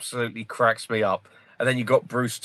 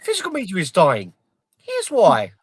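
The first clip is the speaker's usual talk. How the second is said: higher, hammed-up clickbait YouTuber voice; mocking YouTuber; 12 semitones higher than usual. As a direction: parody a rage-bait video host, pitched up. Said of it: "Where it's like".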